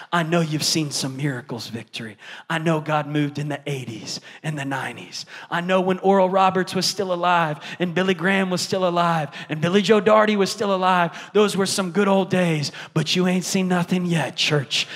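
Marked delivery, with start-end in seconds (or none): none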